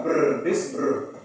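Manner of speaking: "brr" as a rolled, buzzing blend sound. Shown as a demonstration. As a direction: buzz out "brr" with the lips. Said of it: "what's called a consonant blend is this one".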